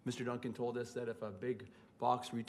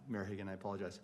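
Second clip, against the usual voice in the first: normal talking voice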